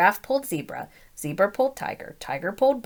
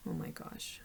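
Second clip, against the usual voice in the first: soft whispered oh my gosh; whispered aside; 18 dB quieter than usual. Real, not acted. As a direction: whisper it under the breath. Aside under breath.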